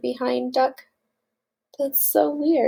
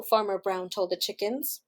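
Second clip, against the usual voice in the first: plain reading voice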